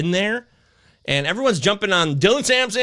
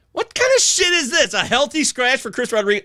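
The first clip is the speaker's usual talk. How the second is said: outraged; 7 semitones higher than usual. Real, not acted. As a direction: blurt it out in disbelief. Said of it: "I'm so pissed right now".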